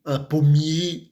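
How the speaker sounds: ordinary talk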